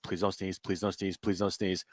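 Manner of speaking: low, hushed repeated plea; silent pleading thought. Retold or imitated. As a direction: plead under your breath like an inner chant. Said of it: "I'm sitting there thinking".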